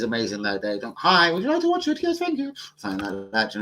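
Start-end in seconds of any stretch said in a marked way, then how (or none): 1.0-2.0 much higher, sweet polite offer; high mock polite voice. Host offering to show the fight, high and polite. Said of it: "acted offer to neighbour". 2.0-2.5 much higher, sweet polite offer; high mock polite voice. Host offering to show the fight, high and polite. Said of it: "acted reply".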